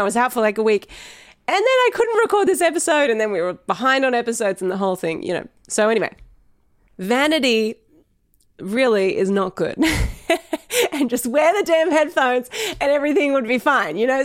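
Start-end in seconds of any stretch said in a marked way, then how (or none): 1.5-3.1 higher, laughing through the words. Real, not acted. Host laughing about missing the recording, laughing. Laughing at her own mishap. 9.8-10.9 short high giggle; real laugh. Real, not acted. Host laughing at her own vanity, giggling. 10.9-14.2 light laughing. Real, not acted. Laughing at her own vanity.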